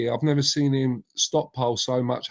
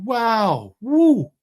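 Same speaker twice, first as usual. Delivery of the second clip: impressed whoops; 8 semitones higher, 4 dB louder than usual. Real, not acted. Admiring singer's vocals.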